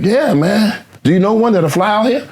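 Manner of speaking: high, eager insistence; retold eager pleading. Acting out the friend asking to fly someone out, eager.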